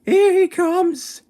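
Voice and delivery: put-on very high, loud little-boy shout; child shouting. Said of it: "a little boy shouted".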